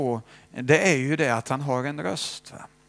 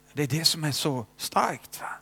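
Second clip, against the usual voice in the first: normal talking voice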